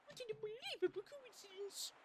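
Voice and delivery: high breathy diva puppet; Miss Piggy voice. Acted. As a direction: camp it up like a pig diva. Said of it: "how do I do Miss Piggy?".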